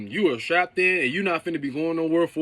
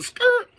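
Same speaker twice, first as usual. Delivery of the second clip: mock brake screech; 7 dB louder than usual. Ride braking to a halt.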